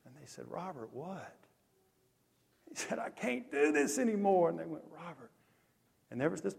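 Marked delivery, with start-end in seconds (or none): 0.5-1.4 hushed, careful prompting; gentle question. Retold or imitated. Counselors to the sobbing camper, very softly. 3.0-4.5 high, strained plea without volume; retold scream. Preacher voicing Robert's plea to counselors, restrained wail. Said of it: "he kept screaming". 4.9-5.3 very soft, hushed name; gentle urging. Retold or imitated. Counselors to the sobbing camper, softly.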